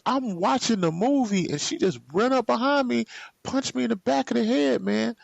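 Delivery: high, fast exasperated account; indignant retelling. Retold or imitated. Explaining the attack to Rashard, high fast exasperation. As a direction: rush out the injustice.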